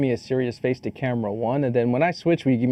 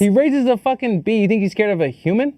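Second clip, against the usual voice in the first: high-pitched comic rant; mock outrage; 7 semitones higher than usual. Friend hyping up an action hero, mock outrage. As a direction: pile on with mock disbelief.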